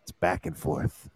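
whispered, breathy aside; whispering. Shown as a demonstration. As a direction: whisper it to the folks.

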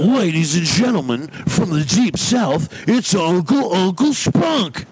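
high, drawn-out announcer call; ring-announcer intro, climbing at the end; show intro. Acted. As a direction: announce the guest with a big stretched build-up. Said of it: "make a quick intro right now".

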